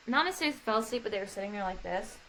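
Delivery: ordinary talk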